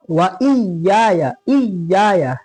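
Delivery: इय्याया with the doubled y pressed; hard doubled y. Shown as a demonstration. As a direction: repeat इय्याया, leaning on the doubled y. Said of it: "सख्ती".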